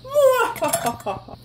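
high burst of real laughter. Real, not acted. Laughing at the blooper, high real laughter. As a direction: crack up at the mishap.

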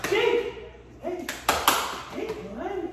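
high excited dog greeting; squeaky praising a dog. Real, not acted. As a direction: call the dog with bright delight.

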